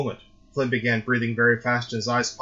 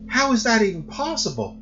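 much higher, pressing worried question; urgent disbelief; 12 semitones higher, 3 dB louder than usual. A narrator's reading. Mother pressing her son in high alarm.

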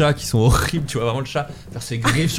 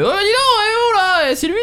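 squawky parrot voice, much higher. Retold or imitated. Narrator voices the parrot accusing Hachou, very high squawk. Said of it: "le perroquet a fait".

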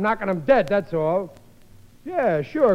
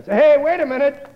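loud raised call; 7 semitones higher, 5 dB louder than usual. Acted. Father calls after the boy, loud shout. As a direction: stop him before he goes.

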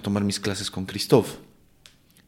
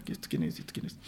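muttered aside; 7 semitones lower, 15 dB quieter, faster than usual. Retold or imitated. Scanning strangers on Zoom.

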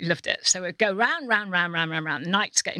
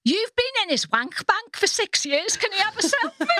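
imitating the woman; 14 semitones higher than usual. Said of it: "she said".

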